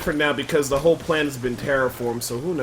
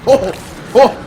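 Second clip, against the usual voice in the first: loud, higher taunting of the enemies; angry taunt; 7 semitones higher, 6 dB louder than usual. Real, not acted. Gamer taunting enemies mid-fight, loud angry taunt. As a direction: throw it at them like a challenge.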